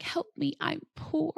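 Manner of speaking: light mock whimper of a movie line; whiny Bridesmaids quote. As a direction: play up the poor helpless act, lightly. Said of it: "in the airplane where she's like".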